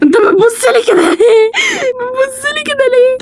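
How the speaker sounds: high frightened cry; half-laughing, half-crying fright; scared shriek. Real, not acted. Scared of the baby's stare.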